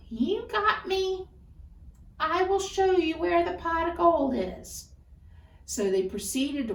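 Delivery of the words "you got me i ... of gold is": a caught leprechaun conceding; high, resigned leprechaun voice; defeated leprechaun. Acted. Leprechaun gives in.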